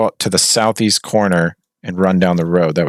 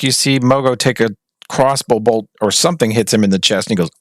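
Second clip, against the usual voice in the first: ordinary delivery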